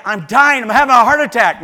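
loud hammed-up cry of dying; mock loud plea. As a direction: overplay the emergency loudly. Mock cry she ignores.

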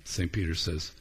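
ordinary talk

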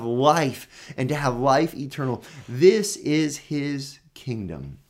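preacher proclaiming the kingdom; fervent preaching. Acted. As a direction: lift the congregation with conviction. Preaching rises again.

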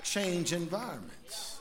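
loud, driving sermon voice with music under it; full force preaching. Acted. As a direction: preach it hard to the whole church.

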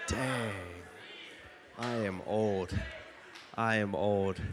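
ordinary delivery

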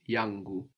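Yangu with a clear A then a clear U; clear vowels. Shown as a demonstration. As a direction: spell out each vowel of Yangu. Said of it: "So it's an A and then an U".

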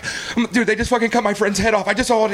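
high, urgent eyewitness alarm; retold trembling panic. Fake escapee telling captive about a beheading, panicked. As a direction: act out a shocked witness. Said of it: "he's, like, shaking and trembling".

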